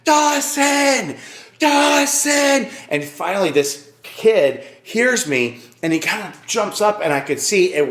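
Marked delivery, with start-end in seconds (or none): 0.0-2.7 high, loud shout across a crowd; yelling. Retold or imitated. Father calling for his lost son, yelling. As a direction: call out the name, drawn out and urgent. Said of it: "I started yelling".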